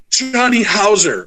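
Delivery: high, slow, crying-out fan gush; starstruck fan gasp. Retold or imitated. Fan meeting her idol at Scarefest, mock starstruck cry. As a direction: gush the name like a trembling fan. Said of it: "she starts trembling".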